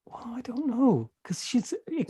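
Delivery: hushed whisper aside. Real, not acted. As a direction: whisper it, as if she might hear. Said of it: "unvoiced aside".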